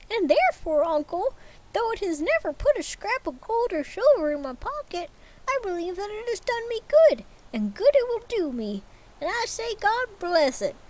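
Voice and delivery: put-on high, fervent young man's voice; earnest nephew. Nephew defending Christmas to Scrooge, high and earnest. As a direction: lift the pitch and plead warmly.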